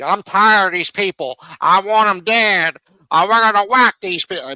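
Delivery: mock ruthless mobster. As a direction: growl it out like a ruthless boss. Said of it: "might have a little ruthless streak".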